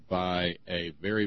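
ordinary delivery

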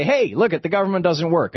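high-pitched, hey-look-everyone call; mock conservatives' announcement. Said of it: "and then they say".

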